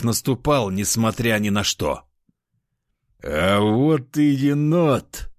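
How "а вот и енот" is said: pleased grin. Acted. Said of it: "заулыбался командир".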